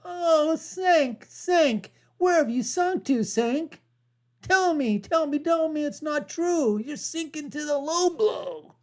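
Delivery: high, pleading melodrama; mock dramatic lament. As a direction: wail his name like a jilted lover.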